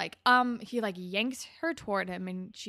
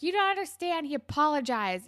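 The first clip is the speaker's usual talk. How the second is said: high, louder mock whine; whiny defensive Terry; 8 semitones higher, 6 dB louder than usual. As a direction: squeak out a defensive excuse. Terry defending Rick, imitated.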